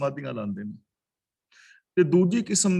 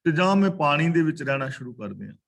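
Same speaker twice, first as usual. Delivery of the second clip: ordinary talk, nothing marked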